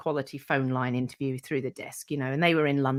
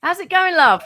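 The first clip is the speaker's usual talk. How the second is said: much higher, loud playful how's it going love; imitating the greeting; 12 semitones higher, 9 dB louder than usual. Mimicking Corey's reply on the phone, high and playful. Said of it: "He'd say it back".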